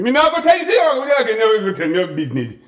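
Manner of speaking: sudden high, indignant outburst; impression of dad resuming the argument. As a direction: burst back into the row as a Caribbean dad. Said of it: "and come back to the argument".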